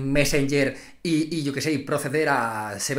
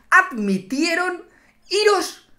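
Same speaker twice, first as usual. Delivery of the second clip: "admitieron" stretched slowly and pitched way up in mock outrage; mock outraged; 12 semitones higher, much slower than usual. Vlogger complaining about the academy, mock outraged and high. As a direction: drag out "admitieron" high in disbelief. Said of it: "mock outrage at RAE".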